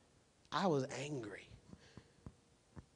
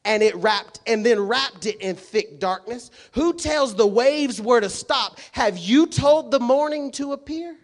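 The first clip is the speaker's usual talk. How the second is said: high, loud rapid-fire questions; booming preaching; 9 semitones higher, 13 dB louder than usual. Acted. Pastor voicing God questioning Job, loud preaching. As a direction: fire each question from the pulpit. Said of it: "It says".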